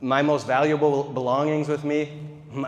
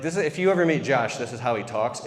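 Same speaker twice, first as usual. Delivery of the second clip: ordinary talk, nothing marked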